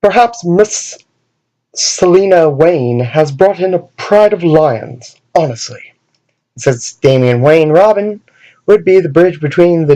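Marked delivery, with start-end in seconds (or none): 0.0-5.9 lightly done fake British butler accent; prim butler resuming his complaint. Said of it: "fake British accent".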